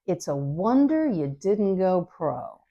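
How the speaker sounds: drawn-out slow tease, dropping at the end; slow teasing. Shown as a demonstration. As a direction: stretch each word to needle him. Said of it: "the final sort of teasing".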